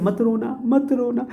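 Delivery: high, soft pretend comforting of crying students; mock consoling. Teacher teasingly consoles confused students, soft and high. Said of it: "don't cry".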